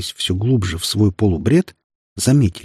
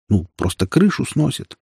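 ordinary delivery

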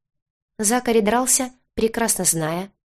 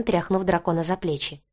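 usual speaking voice

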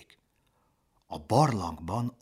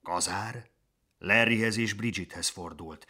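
ordinary delivery

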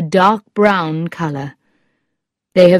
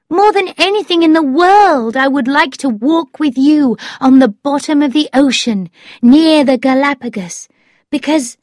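high-pitched, eager declaration; yearning storybook young woman; Thetis, ardent; 9 semitones higher, 4 dB louder than usual. A narrator's reading. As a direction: pour out the wish in a high voice.

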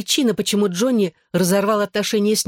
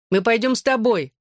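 mild, higher-pitched call; calling after; 5 semitones higher than usual. A narrator's reading. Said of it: "крикнула мне вслед Клэр".

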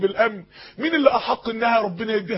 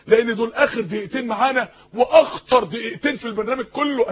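usual speaking voice

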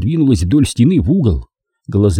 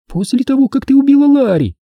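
put-on childlike voice. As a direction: speak like a small child. Toni's childlike voice.

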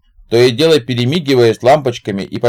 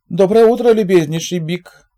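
high sugary politeness; 9 semitones higher than usual. A narrator's reading. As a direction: lay the courtesy on thick. Zig answers in high voice.

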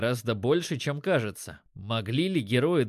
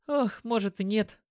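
high-pitched, lightly sighed reply; sighing; 8 semitones higher than usual. A narrator's reading. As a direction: sigh a little as you doubt it. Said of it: "вздохнула Лисе".

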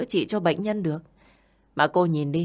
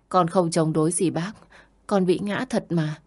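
ordinary delivery

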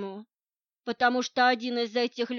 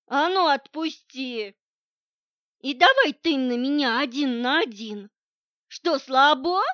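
put-on loud drunk taunting; drunk bravado; 3 semitones higher, 6 dB louder than usual. A narrator's reading. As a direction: pick a fight like a drunk. Captive feigning drunk bravado.

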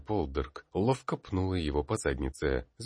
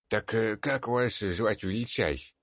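put-on old man speaking with a grunt; creaky old peasant, grunting; groaning old man; 7 semitones higher than usual. A narrator's reading. Old man asks name.

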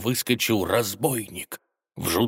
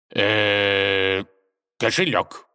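hesitant decision; 3 dB louder, much slower than usual. A narrator's reading. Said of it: "решился он".

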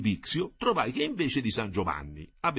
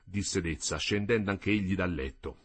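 usual speaking voice

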